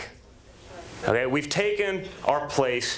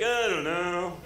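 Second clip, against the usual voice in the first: reluctant; 3 semitones higher, much slower than usual. Retold or imitated. Retold reluctant reply.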